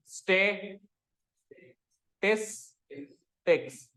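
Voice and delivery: स्टे, टिस, टिक्स said one at a time with pauses; स्टे टिस टिक्स as three separate beats; separate syllables. Shown as a demonstration. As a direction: chop स्टे टिस टिक्स apart clearly. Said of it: "अब एक साथ बोल".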